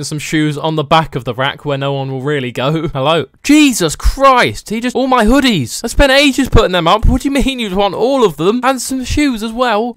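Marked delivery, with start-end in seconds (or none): 3.4-9.9 high-pitched, exasperated astonishment; shocked disbelief. Pretend. Shopkeeper whose hoodies are all being bought, shocked disbelief.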